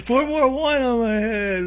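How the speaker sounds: high, slow, drawn-out mock rapture; hammed-up beatnik painter carried away; ecstatic artist.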